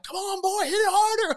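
high, loud jeering goad; needling heckle, pushed high and loud; mocking taunt. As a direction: egg him on, making fun, pitch way up. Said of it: "I'm mocking him and making fun of him".

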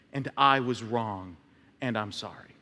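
mean every word, quietly; a quiet, humble apology that truly owns it; sincere apology. Shown as a demonstration. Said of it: "I'm not just gonna go".

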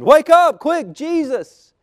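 high, loud, urgent yell; screamed plea. A narrator's reading. Fishermen waking Jesus in the storm, screamed plea. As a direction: shout to rouse a sleeper in an emergency. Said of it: "they screamed".

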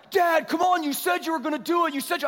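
put-on high, loud, insistent kid protest; pestering kids. Kids egging on their scared dad at the slide, high pleading.

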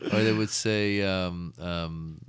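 ordinary delivery

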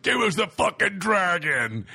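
loud, high, drawn-out demand; full-voiced mock threat; menacing yell. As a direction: burst in yelling like raiders. Kids break in demanding dragon.

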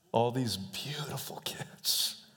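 a slow, tender near-whisper; hushed whisper. Real, not acted. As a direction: whisper it softly, moved. Tender memory of orphanage kids.

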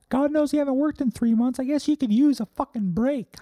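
a sarcastic little number sulking and guilt-tripping; high-pitched passive-aggressive sulk from forty-four; sulky number voice. Acted. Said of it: "Forty-four is like".

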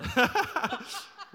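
loud, high burst of laughter; real laughter. Real, not acted. Preacher reacting to co-host's car joke, hearty laugh. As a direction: laugh out loud, caught by surprise.

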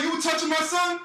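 high-pitched imitation of irate dad; retold angry challenge. Dad confronting man about his son, mock angry. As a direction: demand an answer, as a retold quote.

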